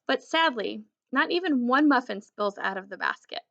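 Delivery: ordinary delivery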